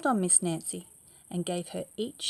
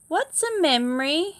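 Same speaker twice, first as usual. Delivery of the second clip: loud question; 9 semitones higher, 11 dB louder than usual. Acted. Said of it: "he asked".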